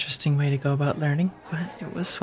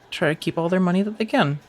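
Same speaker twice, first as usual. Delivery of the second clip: ordinary talk, nothing marked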